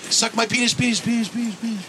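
high call fading into repeats; imitated echo. Shown as a demonstration. Joking into the distance, high fading echo imitation. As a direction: call out, then trail off as your own echo. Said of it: "And you're like".

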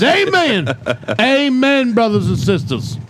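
high, ringing amen; preacher voice. Acted. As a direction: proclaim it from the pulpit. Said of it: "this new character called Pastor Pat".

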